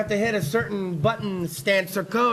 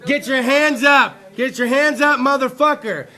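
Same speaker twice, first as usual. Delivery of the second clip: raised voice, slightly higher; imitated yelling; 4 semitones higher, 5 dB louder than usual. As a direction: mimic robbers yelling. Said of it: "Both of them are yelling at me".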